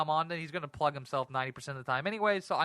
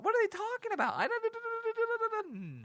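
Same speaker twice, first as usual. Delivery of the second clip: very high, squeaky bewildered falsetto; mock baffled squeak; slower than usual. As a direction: squeal it like you can't believe the question. Baffled by strange question.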